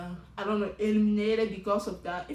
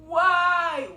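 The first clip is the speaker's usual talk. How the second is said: dismayed cry; 8 semitones higher, 12 dB louder than usual. Real, not acted. Dismayed at contestant's choice.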